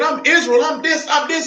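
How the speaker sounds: high, mocking proud boast, rising to a shout; hammed-up voice of the self-assured. Preacher mocking the congregation's boast, high and shouting. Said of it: "And we thinking".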